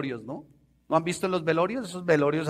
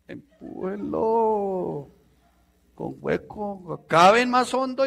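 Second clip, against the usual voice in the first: slow, mocking drawn-out sarcasm; sarcastic jab; 4 semitones higher, much slower than usual. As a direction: relish the sarcasm slowly. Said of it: "me provoca hacerle". (sarcasmo lento y burlón)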